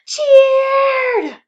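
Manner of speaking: high loud triumphant cheer. A narrator's reading. Narrator voicing the carrots' victory. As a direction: burst out cheering.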